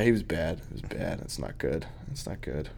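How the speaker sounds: muttered verdict. Real, not acted. Muttered verdict on bad poker face.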